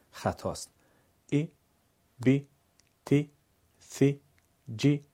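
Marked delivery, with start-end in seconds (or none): 1.3-5.0 each letter with a short i, one beat; short Arabic kasra syllables. Shown as a demonstration. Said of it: "به اندازهٔ زدن یک ضربه".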